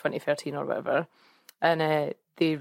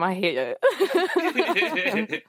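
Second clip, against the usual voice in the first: higher, very slow, laughing "I hate it"; laughing mock despair; 7 semitones higher, 3 dB louder, much slower than usual. Real, not acted. Joking about deleting the first episode, laughing. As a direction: drag it out through giggles.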